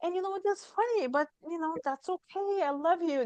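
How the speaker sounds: the inner parent comforting a scolded self, tender and high; loving inner parent. Retold or imitated. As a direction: soothe yourself like a kind parent to a child. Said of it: "the inner parent was just like".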